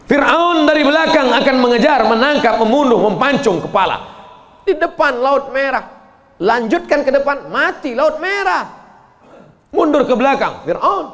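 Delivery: loud and high, urgent storytelling; dramatic preaching. Acted. Preacher tells of Pharaoh chasing, loud and urgent. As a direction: build the panic of being trapped at the sea.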